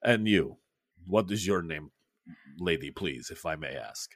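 low, soft courteous official; Khrushchev voice. Acted. Khrushchev asking Ella her name, low. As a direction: gently ask her name. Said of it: "he says".

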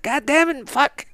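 high-pitched mock cursing outburst; Bill Burr cussing. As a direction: curse in exasperation, imitating a comedian's rant. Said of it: "he does his cussing".

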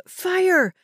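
high, sharp panicked shout; shouted alarm. A narrator's reading. A passenger in the burning cabin, shouting. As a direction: cry it out in alarm. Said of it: "Shouts of".

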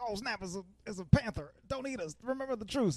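high, startled animal voices; alarmed pigs. Retold or imitated. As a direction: pipe up like nervous little prey. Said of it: "the pigs or whatever, they're like".

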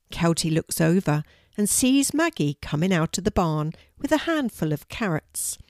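usual speaking voice

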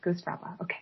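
soft unvoiced whisper. Real, not acted. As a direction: breathe it as an aside. Whispers a joke aside.

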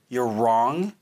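normal talking voice

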